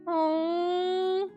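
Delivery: long high-pitched aww. Real, not acted. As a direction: melt at something cute. Tender scene, reacting.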